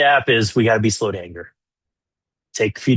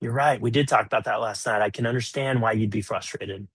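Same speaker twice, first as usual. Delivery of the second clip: normal talking voice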